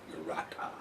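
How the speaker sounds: Urata whispered as "yur-AH-ta"; pronounced with Y sound. Shown as a demonstration. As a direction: try Urata under your breath, soft Y start. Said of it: "it is pronounced".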